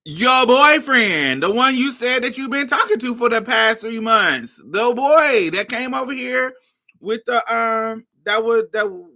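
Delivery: put-on an exasperated run reminding a friend about his boyfriend. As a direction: lay it all out, fed up. Exasperated explaining to Mario.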